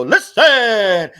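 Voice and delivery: loud, high, stretched falling shout; grandfather-style holler. Retold or imitated. Speaker grabbing fidgeting schoolkids' attention on the microphone, drawn-out holler. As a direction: holler it to startle the whole room. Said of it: "I do the same thing".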